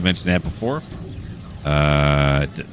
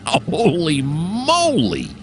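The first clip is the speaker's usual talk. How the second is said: amazed laugh; 9 semitones higher, much slower than usual. Real, not acted. Awed by the giant engine.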